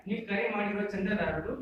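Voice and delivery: rapid recorded-message announcement; phone recording voice. Retold or imitated. Mimicking the phone line's reply to friends calling him, fast recorded message. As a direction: rattle it off like an automated phone message. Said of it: "when you are giving a reply".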